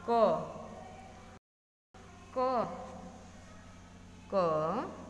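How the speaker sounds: क said alone with its अ vowel held out; drawn-out letter sound. Shown as a demonstration. Teacher showing students the vowel inside the consonant, drawn-out क. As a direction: sound out क slowly, letting the अ ring. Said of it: "क के साथ हमारी ध्वनि जो निकल रही है".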